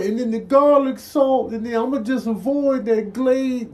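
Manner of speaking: high-pitched voice planning past obstacles; nervous strategist. Acted. Contestant plotting a way past the seasoning salt, nervous. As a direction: talk yourself through the obstacle course, high.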